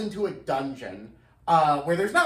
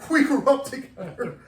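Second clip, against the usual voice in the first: parodying a melodramatic actor, higher and louder, half laughing; mock grieving hero; 6 semitones higher, 4 dB louder than usual. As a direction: ham up a wounded lament. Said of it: "they go on like that".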